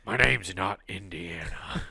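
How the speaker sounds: low, slow action-hero impression; quiet, drawn-out hero voice; Harrison Ford. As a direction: lightly imitate a movie star, soft and slow.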